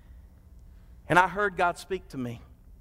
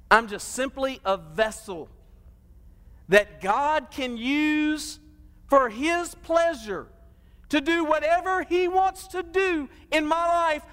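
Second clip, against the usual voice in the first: higher, slow, drawn-out sermon build; full-force preaching; 9 semitones higher, slower than usual. Acted. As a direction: preach it, stretching each phrase upward.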